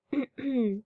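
short throat clear before reading. A narrator's reading. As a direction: clear your throat to start. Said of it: "She cleared her throat".

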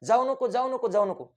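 high, frantic cries of warning; urgent high-pitched repeated pleading; women yelling at the screen. Acted. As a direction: mimic village women shouting at a film heroine. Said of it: "लोकच ओरडायला लागतात, त्या बायाच ओरडा".

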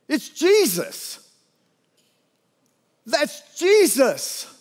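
slow and high, full of wonder; awed recognition. Pretend. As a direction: breathe it out in amazed relief. Disciple recognizes Jesus on water.

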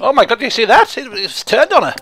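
high, loud astonished outburst; shrieky shock, about to burst out laughing; amused shock. Real, not acted. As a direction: exclaim in amused disbelief. Pet turtle attacks the character.

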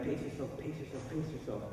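soft, low mutter to himself; hushed and low, pacing himself; quiet self-coaching. Retold or imitated. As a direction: repeat it under your breath like a running mantra. Coaching himself quietly mid-race.

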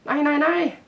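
high, loud panicked cry; screamed. A narrator's reading. Girl fights off the voices in her nightmare, screaming. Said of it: "skreg Maja og hulkede".

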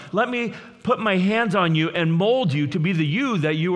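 high, loud, fervent sermon delivery; preaching at full force. Acted. Pastor stirring up the congregation, preaching at full force. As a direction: preach it out, lifting the whole room.

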